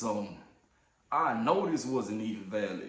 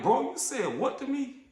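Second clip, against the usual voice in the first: incredulous outrage; 12 semitones higher than usual. Retold or imitated. Retold outrage at safety guy.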